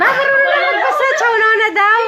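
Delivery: very high, laughing outburst; laughing shout. Real, not acted. Relaying a greeting to Nonna, laughing shout.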